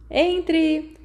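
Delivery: high, bright call to the door; cheerful shout. Acted. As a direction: shout it out gladly. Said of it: "James gritou alegremente".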